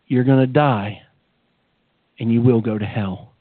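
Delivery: a deep, measured pronouncement from God. Retold or imitated. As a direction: deliver it as a solemn divine warning. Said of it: "And God revealed to me".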